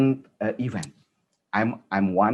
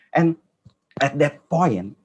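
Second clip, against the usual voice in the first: ordinary talk, nothing marked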